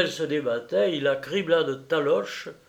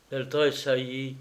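ordinary delivery, nothing marked

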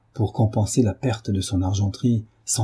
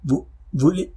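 higher, broken angry stammer; spluttering disbelief, pitched up; stammering with anger; 6 semitones higher than usual. A narrator's reading. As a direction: stumble over the words in rage. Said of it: "en balbutiant de colère".

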